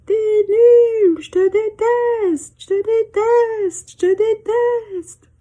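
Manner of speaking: high, slow repeats, fading; angry words bouncing back; echo. Acted. Mountains echoing the girl's angry words. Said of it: "echo of her yell".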